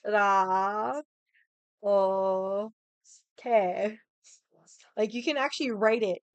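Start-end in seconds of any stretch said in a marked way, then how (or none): 0.0-4.0 karaoke broken into ka, ra, o, ke with pauses between; ka, ra, o, ke, each syllable set apart. Shown as a demonstration. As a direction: spell out the syllables slowly, one by one. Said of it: "there's characters".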